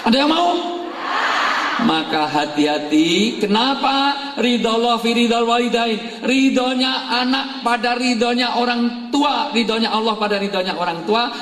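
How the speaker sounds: high, ringing sermon voice; preacher rousing the congregation; preaching at full force. Real, not acted. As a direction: preach it out to the hall.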